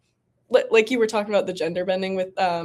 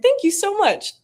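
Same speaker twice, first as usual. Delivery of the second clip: bright thank you; 12 semitones higher, 3 dB louder than usual. Retold or imitated. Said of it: "I'm gonna smile and I'm like".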